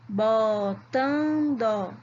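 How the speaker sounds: "Botando" stretched into bo, tan, do; slow syllable by syllable. Shown as a demonstration. Teacher checking syllable counts with pupils, "Botando" slowly split. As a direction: say "Botando" very slowly, each syllable held long. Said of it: "Vamos falar todas devagarinho".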